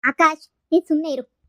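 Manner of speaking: name barked, then a warning; sharp reprimand. Acted. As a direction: shut a friend up firmly. Silencing friend who answers back.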